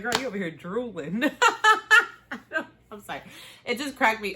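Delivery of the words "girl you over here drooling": giggling at her own face; laughing self-tease. Real, not acted. Host teasing herself about drooling while watching, laughing. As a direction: tease yourself through laughter.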